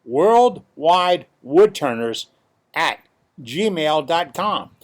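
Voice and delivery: slow, loud announcer read. Shown as a demonstration. Host reading the club email to viewers in a slow, loud announcer voice. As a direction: boom it out like a radio announcer. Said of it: "here's the announcer voice".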